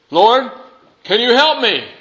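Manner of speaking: high, loud plea upward; pleading call. Acted. Man on the branch shouts his plea to the Lord.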